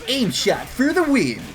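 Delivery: hammed-up announcer. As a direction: announce it like a victory banner. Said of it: "And it says".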